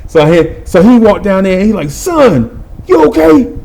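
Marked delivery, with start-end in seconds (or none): 1.9-3.5 a lightly voiced concerned coach, pitched higher; coach checking in. Coach to the winded boy, lightly higher concern. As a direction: ask if he's okay, a touch higher.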